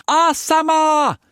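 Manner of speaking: high, loud drawn-out call; shriek. A narrator's reading. Mei Mei calling her servant from the door, high shriek.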